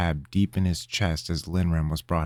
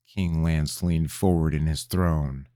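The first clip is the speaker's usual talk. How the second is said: plain reading voice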